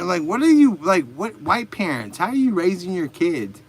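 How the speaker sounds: normal talking voice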